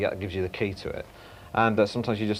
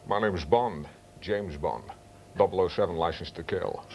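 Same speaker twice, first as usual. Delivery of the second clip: lower, deep Connery voice from the side of the mouth; Sean Connery impression with depth; 4 semitones lower than usual. Shown as a demonstration. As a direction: sink into the real Connery depth. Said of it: "they don't get the actual depth".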